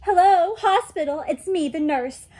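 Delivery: loud, high, bossy little nurse calling; pretend nurse on the phone. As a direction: play-act a nurse ringing the hospital. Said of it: "I pretended to call the hospital".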